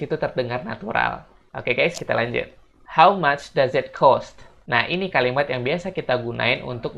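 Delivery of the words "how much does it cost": slow model question. Shown as a demonstration. Teacher models English phrase.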